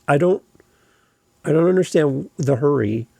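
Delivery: ordinary talk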